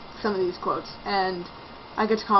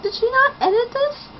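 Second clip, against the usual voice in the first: high-pitched disbelieving question; incredulous; 14 semitones higher than usual. Real, not acted. Reviewer baffled that the book went unedited, incredulous.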